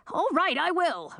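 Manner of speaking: high, startled squeak; cowed high-pitched squeak. A narrator's reading. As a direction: give in with a high yelp of protest. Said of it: "Olabald squeaked".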